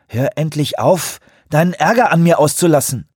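laut und hoch, aufgebracht; wütend anfahrend. A narrator's reading. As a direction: jemanden scharf anfahren. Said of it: "fuhr sie ihn an". (wütend angefahren)

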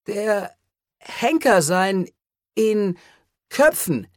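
hoch, stockend, mit langen Pausen; wirres Stammeln. A narrator's reading. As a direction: benommen Bruchstücke hervorbringen. Cole wirr nach Anfall. (Cole stammelt benommen zu Rios, hoch und zerhackt)

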